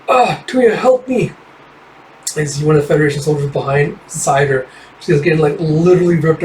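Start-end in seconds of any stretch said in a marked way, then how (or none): 0.1-1.3 high, strained cry for help; pained plea. Acted. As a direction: beg through the pain. Transforming, begging for help.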